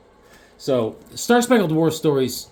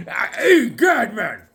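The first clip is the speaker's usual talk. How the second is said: very high, loud comic yelp; acted outcry; 8 semitones higher, 4 dB louder, slower than usual. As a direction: yelp it like a character being smacked. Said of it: "Enemy Ace is like".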